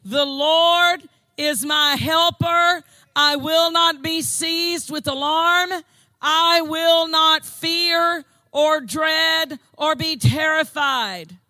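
a preacher's bold shout of faith; bold declaration. Acted. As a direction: proclaim it boldly, each word deliberate. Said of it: "we confidently and boldly say".

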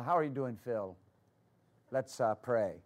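quiet, low murmured voice of a soft-spoken man; soft-spoken Lawrence. Retold or imitated. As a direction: imitate a very soft-spoken friend gently inviting prayer. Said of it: "he talks like this right here".

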